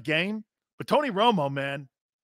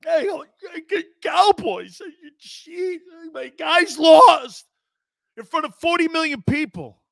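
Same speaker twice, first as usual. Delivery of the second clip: high, whiny sobbing voice; mock crying; 9 semitones higher, 7 dB louder than usual. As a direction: whimper like a sore loser. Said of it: "I thought he was gonna have tears".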